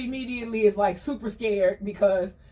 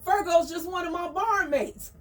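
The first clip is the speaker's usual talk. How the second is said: higher, smooth-talking cat; Aster smoothing it over; 8 semitones higher than usual. Retold or imitated. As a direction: soothe her, pitched up. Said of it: "And Aster was like".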